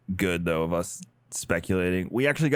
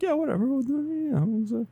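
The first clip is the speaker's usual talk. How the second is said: mock laid-back, high-pitched mumble; easygoing guests. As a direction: imitate someone too chill to care. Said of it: "They're the most easygoing".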